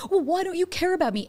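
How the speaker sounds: high, pleading complaint; mock the needy reaction; whiny accusation. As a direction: raise the pitch into a petulant demand.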